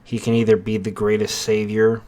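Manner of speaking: portentous storyteller, letting each phrase hang; ominous narration. A narrator's reading. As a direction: intone it like a foreboding prophecy.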